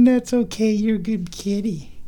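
high, soothing coo. Real, not acted. Owner soothing her cat in high baby talk. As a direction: reassure the kitty sweetly.

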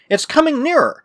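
high-pitched urgent exclamation; sudden cry. A narrator's reading. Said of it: "suddenly cried Mark".

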